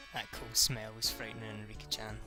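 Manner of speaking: ordinary delivery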